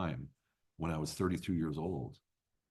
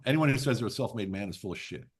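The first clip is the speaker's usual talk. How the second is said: normal talking voice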